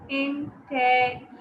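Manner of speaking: "Intelligent" slowly broken into IN-TEL-LI-GENT; counting syllables. Shown as a demonstration. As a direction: sound out each syllable of "Intelligent" separately. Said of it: "How many?".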